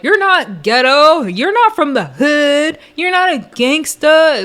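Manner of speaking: exaggerated sing-out mockery; mocking retold attacks. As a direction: parody petty insults, stretching each phrase. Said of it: "attacks that are like".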